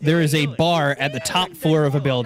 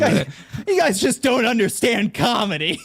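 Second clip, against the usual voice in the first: mock outrage; 7 semitones higher than usual.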